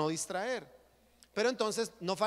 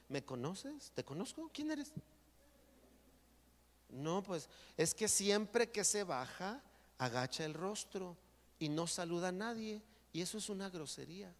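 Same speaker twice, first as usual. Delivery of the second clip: much softer, hushed retelling; quiet retold exchange; 6 dB quieter than usual. Pastor quietly retells his talk with the couple, hushed. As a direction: keep it hushed, like a private talk.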